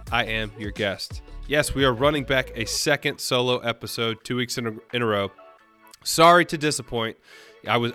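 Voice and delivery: usual speaking voice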